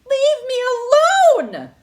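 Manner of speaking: high, loud shout fading on alone; old woman shouting at moon men. Acted. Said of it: "the old woman shouted".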